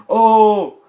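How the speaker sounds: high, loud startled "Oh!"; a sudden burst of shock; surprised gasp. Retold or imitated. Max reacting as the AI speaks, surprised and loud.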